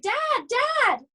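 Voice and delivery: high frightened call for dad. Acted. Scared boy calls dad into his bedroom. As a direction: cry out for your father. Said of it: "he shouted".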